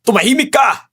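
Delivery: high-pitched, sharp order; angry shout. Acted. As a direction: shut the captive up angrily. Captor silencing a shouting captive.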